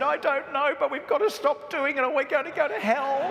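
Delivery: put-on high, laughing child's voice; high, giggly child explaining; young boy. Young self answers friend.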